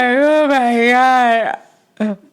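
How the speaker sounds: high, loud anguished "oh my God"; hammed-up heartbroken cry; melodramatic wail. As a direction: wail it like a soap opera heroine. Dramatic breakup wail at home.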